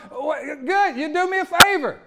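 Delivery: high, loud, laughing dare; defiant taunt. Retold or imitated. Paul mocking those who would kill him, loud and laughing. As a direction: toss it back at them with a laugh. Paul scoffing at death threat.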